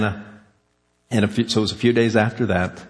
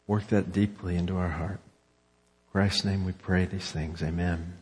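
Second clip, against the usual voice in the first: soft, earnest prayer that fades softer; closing prayer; 2 semitones lower, 4 dB quieter than usual. Acted. A pastor prays over his congregation, soft and earnest. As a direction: pray quietly for the whole church.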